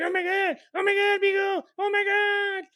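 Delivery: high shrieking oh my God, laughing; mock panicked shriek. Husband imitating his wife's panic at a bumblebee, high and shrieking. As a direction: squeal it in fake panic. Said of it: "You're just like".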